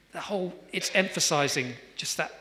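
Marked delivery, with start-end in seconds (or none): none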